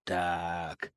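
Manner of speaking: quiet muttering under breath; muttering to himself. Acted. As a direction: count it over under your breath. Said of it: "пробормотал себе под нос".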